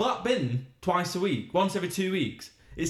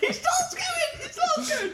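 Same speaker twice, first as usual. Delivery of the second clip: very high squeal through laughter; laughing shriek; slower than usual. Real, not acted. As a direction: shriek it while cracking up. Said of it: "laughing shriek".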